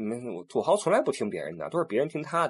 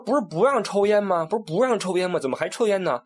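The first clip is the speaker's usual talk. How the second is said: higher, exasperated repeated question; exasperated scolding; 7 semitones higher than usual. Retold or imitated. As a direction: scold them in disbelief. Guide scolding smoking tourists. (导游气急地质问)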